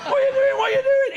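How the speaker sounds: high, loud shouting at the lad; retold furious yell. Coach driver yells at the lad pissing in the aisle, high and loud. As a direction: bellow it like a livid coach driver.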